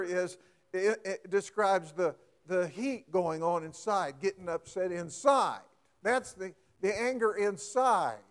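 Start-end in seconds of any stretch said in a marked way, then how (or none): none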